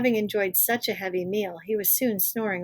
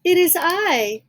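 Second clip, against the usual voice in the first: put-on wolf faking a high, loud little-girl voice; wolf pretending to be the granddaughter, high and slow; high-pitched voice; 7 semitones higher, 9 dB louder, slower than usual. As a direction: squeak it high to fool Granny. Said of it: "said the wolf in a high-pitched voice".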